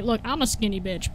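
usual speaking voice

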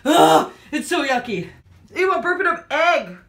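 disgusted. Real, not acted. Disgusted after bite.

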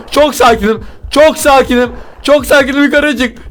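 high, frantic self-reassurance; panicked. Real, not acted. Player fleeing upstairs claims to be calm, panicked. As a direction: insist on calm while panicking.

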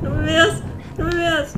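high tense plea; pleading. Real, not acted. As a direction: beg not to be seen. Hiding from the old man.

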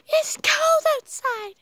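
high shrill fussing mom; overprotective mom voice. Retold or imitated. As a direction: squeal it like a worried mother. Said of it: "my mom is all overprotective".

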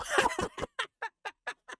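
high breathy burst of disbelieving laughter; a short high laugh of disbelief; incredulous laugh. Real, not acted. Laughs at the absurdity.

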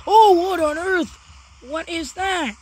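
loud, high cry of astonishment; startled outburst. Real, not acted. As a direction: blurt it out in shock at the sight. Startled by glitching zombie in game.

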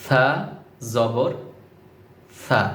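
সা with the tongue tip touching the upper front teeth, then জবর; careful pronunciation. Shown as a demonstration. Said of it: "এভাবে".

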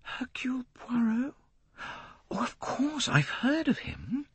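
a stunned, breathless whisper; struck, hushed. A narrator's reading. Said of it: "Lynette seemed struck by the information".

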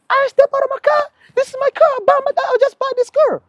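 mock outraged driver talking back to police; hammed-up defiant driver.

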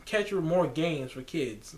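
ordinary delivery

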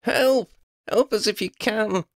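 high-pitched urgent cry for help; high, pleading shout. A narrator's reading. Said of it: "she shouted as loudly as she could".

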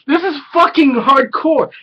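high, loud, stunned whoa; amazed exclaim. Retold or imitated. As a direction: react like you can't believe it. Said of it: "And I'm like".

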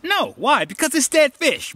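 high-pitched, indignant punchline; emphatic comic refusal. Real, not acted. Telling why he won't eat another piece, comically adamant. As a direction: leap up in pitch to reject it.

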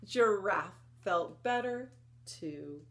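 usual speaking voice

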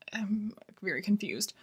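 soft unvoiced aside, as if to herself; whispered aside. Real, not acted. Reviewer whispering her confusion to viewers. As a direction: whisper the confusion under your breath.

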